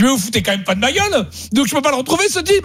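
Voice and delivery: hammed-up disbelief at the clerk; mock outrage. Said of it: "Je dis".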